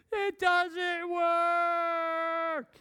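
high, very slow mock sob; mock crying kid. Son sobbing to dad that the piece won't fit. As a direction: wail it like a tearful little boy.

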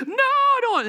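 high-pitched mock whine; imitated child's protest. Son refusing jammies from dad, imitated high protest. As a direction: wail the refusal like a little kid.

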